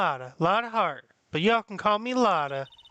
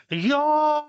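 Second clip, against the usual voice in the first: put-on Southern accent; 7 semitones higher, 5 dB louder than usual. Voicing the witness's twang.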